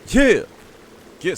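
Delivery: high, loud cheer of victory; real burst of gamer triumph; triumphant yell. Real, not acted. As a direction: shout it out like you just won. Cheering at a game moment.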